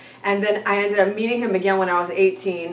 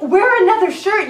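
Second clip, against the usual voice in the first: higher and louder, incredulous; exasperated retort; 10 semitones higher, 6 dB louder than usual. Retold or imitated. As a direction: snap back in disbelief.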